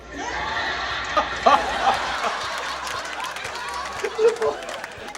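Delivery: high, breathless burst of laughter; can't hold it in, laughing; real laugh. Real, not acted. Vlogger cracks up at clip.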